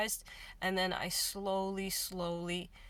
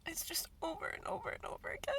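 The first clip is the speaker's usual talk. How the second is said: tearful, broken whisper, pitch pushed higher; real crying, words half whispered; 3 semitones higher, 5 dB quieter than usual. Real, not acted. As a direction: fight through real tears. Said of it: "I'm crying now".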